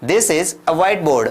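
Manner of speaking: slow, clear classroom drill; slow model sentence. Shown as a demonstration. Teacher drilling simple sentences slowly for learners. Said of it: "क्या कहेंगे?".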